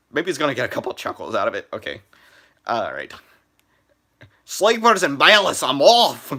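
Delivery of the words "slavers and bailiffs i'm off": loud, high Irish-Scottish brogue; Duncan's Irish accent. Retold or imitated. Vlogger doing Duncan's voice for viewers, loud brogue. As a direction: belt it out like Duncan the engine. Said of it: "I'm gonna try to mimic Duncan's voice".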